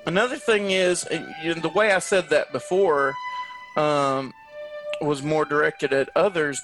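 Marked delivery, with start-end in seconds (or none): none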